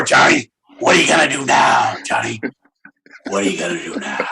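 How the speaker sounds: mock menacing mentor with a nickel; goading Johnny. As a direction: needle little Johnny hard and quick. Voicing an adult goading Johnny.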